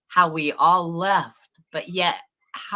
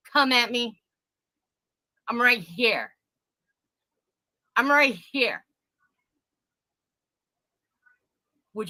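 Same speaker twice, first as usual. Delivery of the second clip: higher, louder, fast challenge; defiant taunt; 6 semitones higher, 4 dB louder than usual. Real, not acted. Taunting the rival to come at her, defiant. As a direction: dare her to come at you.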